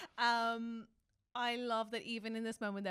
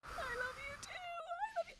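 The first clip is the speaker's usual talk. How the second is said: much higher, softer, close to crying; teary gush; 6 dB quieter than usual. Pretend. Host gushing over the hero's reply, high and teary. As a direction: squeak it out, overcome with feeling.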